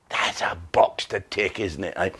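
whispered conspiratorial aside. Real, not acted. Comedian whispering a knowing aside to his viewers. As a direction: lean in and whisper it. Aside about a sensitive name.

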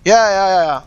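ordinary delivery

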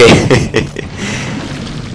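soft breathy chuckle; a quiet laugh to himself. Real, not acted. Laughs after failing.

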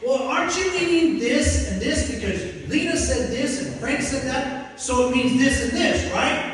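loud, eager know-it-all; excited mock eagerness; eager interruption. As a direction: blurt out the answer proudly.

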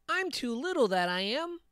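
tiny, high-pitched little goat; very small voice. A narrator's reading. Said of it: "in his very small voice".

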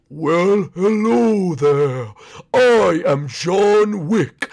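slow, grand, booming legend; slow loud majestic reveal; John Wick god voice. Acted. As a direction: announce yourself like a godlike hero. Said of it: "Your voice sounds like God himself".

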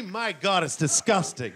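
ordinary talk

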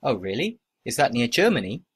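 ordinary talk

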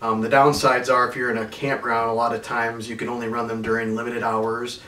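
ordinary talk, nothing marked

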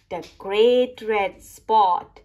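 ordinary delivery, nothing marked

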